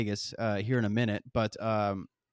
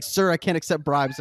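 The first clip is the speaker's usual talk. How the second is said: raised, louder and higher lady's voice; retold loud old lady; 6 semitones higher, 6 dB louder than usual. Old lady loudly refusing the chocolate, raised and higher. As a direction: mimic her loud reply at the plane door. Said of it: "she was talking all loud".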